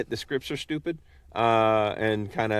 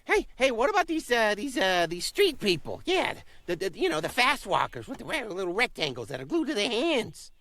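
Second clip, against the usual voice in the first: high, excited goose voice; a dim, eager goose; 9 semitones higher, 4 dB quieter than usual. Acted.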